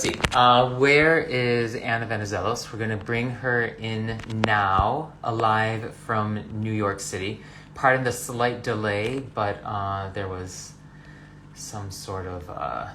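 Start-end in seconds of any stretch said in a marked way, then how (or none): none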